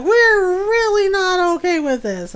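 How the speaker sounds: high, loud hammy protest; mock displeasure. Upset animals refusing the farmer's apology, loud mock displeasure. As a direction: object with pretend indignation, pitched high. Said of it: "they are most displeased with me".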